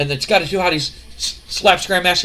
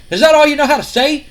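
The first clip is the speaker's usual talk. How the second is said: high, loud sneering mimicry of yo dog; mock his catchphrase over and over; 9 semitones higher, 6 dB louder than usual. King Lawler ridicules Scotty's catchphrase, loud and sneering. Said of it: "Jerry the King Lawler is just like".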